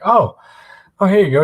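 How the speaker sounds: change your tune when you realize who he is; surprised bouncer. Retold or imitated.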